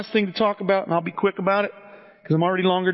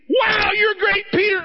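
hammed-up overjoyed cry of praise; mock gushing praise; 12 semitones higher than usual. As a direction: gush like a mock cheerleader. Mocking Jesus praising Peter.

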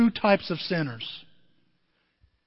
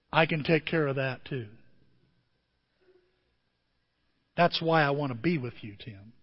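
low, soft reassurance; 6 semitones lower, 5 dB quieter than usual. Retold or imitated. God answering the sinner.

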